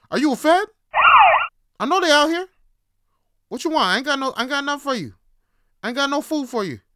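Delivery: high, loud, agitated confrontation; hammed-up suspicious challenge. Confronting a suspected fed, loud mock-paranoid yelling. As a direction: accuse them like you've spotted an informant.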